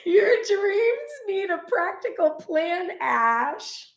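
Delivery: high, slow words squeezed out through laughter; can't stop laughing while reading it out. Real, not acted. Reader to moderator Ash about his reading, laughing. Laughing at card's pointed message.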